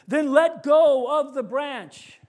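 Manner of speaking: voice of God. Retold or imitated. God's voice in a joke.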